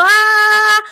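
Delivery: "¡ah!" pushed high and loud from the throat; a strained, squeezed "¡ah!"; tense high "ah". Shown as a demonstration. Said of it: "si yo tengo la voz así". ("¡ah!" agudo y tenso, forzado)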